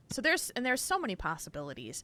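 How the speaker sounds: normal talking voice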